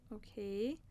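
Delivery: normal talking voice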